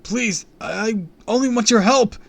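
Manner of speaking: high, loud pleading wail. A narrator's reading. Kid held overhead begging the villain, wailing. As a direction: wail it, faltering in desperation. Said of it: "I wailed".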